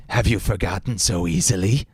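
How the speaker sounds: eerie, knowing villain voice; sinister villain. Acted. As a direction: ask it like a monster mocking its maker. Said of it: "the subject asked".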